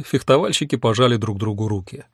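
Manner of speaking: usual speaking voice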